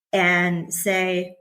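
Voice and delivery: ordinary talk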